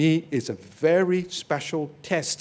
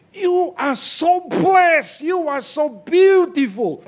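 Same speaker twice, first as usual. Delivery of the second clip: much higher, louder shout, very slow and stretched; gushing praise; 12 semitones higher, 7 dB louder, slower than usual. Retold or imitated. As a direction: cry out the praise in a put-on voice. Acting an admiring woman's praise.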